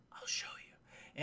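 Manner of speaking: very soft, slow whisper. Retold or imitated. Streamer as the park owner answering his guest, slow whisper. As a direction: breathe it out as a hushed promise. Acts out Hammond's hushed promise.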